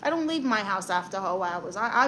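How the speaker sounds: ordinary talk, nothing marked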